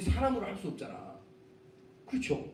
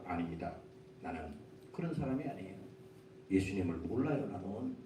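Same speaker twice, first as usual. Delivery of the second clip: lower, softer, hushed denial; a lightly voiced Peter backing away quietly; Peter's denial; 11 semitones lower, 10 dB quieter than usual. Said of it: "이 베드로가 거기서 단연코 거부합니다". (베드로의 낮고 조용한 부인)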